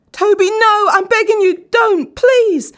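high, loud screaming plea; terrified begging. A narrator's reading. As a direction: scream for your life. Dan begs for his life.

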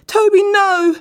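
high, loud screaming plea; terrified begging. A narrator's reading. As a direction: scream for your life. Dan begs again.

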